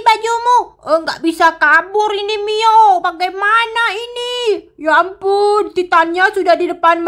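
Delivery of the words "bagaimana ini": ordinary talk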